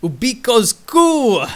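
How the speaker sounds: loud, slow, booming headline; dramatic announcement. Acted. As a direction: announce it like breaking news. Dramatic event title announced.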